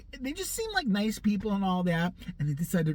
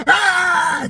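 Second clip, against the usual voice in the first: loud sudden yell out the window; shouting at neighbors from the window; pretend yell; 11 semitones higher, 13 dB louder than usual. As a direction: scream to startle them. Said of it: "I just wanna open the window".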